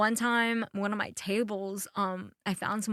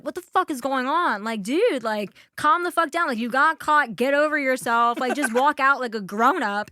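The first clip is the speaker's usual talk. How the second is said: louder, higher mock telling-off; retold exasperated scolding; 4 semitones higher, 6 dB louder than usual. Waitress telling a caught drunk to leave, exasperated. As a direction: tell someone off, half-heartedly heated.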